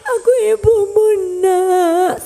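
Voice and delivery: high, loud crying plea; tearful plea. Acted. Mother begging her son to know her. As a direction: beg through tears.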